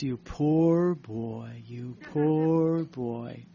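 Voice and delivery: put-on a dying priest pitying the young visitor, slowly; priest's pitying words. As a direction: linger on poor like a gentle old priest. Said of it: "he says".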